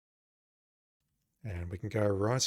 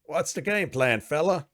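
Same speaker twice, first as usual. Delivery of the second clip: louder, higher put-on character voice; weapons officer voice; 6 semitones higher, 6 dB louder than usual. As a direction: play a game officer greeting the player. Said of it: "not gonna be able to keep these voices up".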